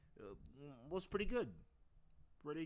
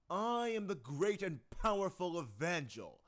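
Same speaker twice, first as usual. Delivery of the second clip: loud, higher, slow grandiose boast; Evangel voice; 5 semitones higher, 5 dB louder, slower than usual. Shown as a demonstration. Evangel boasting he's the best AC, loud and slow. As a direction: boom it like a self-proclaimed champion. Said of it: "Take two for Evangel".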